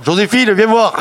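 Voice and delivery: high call to Joséphine. Retold or imitated. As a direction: call her over from the next room. Patient calls out for Josephine.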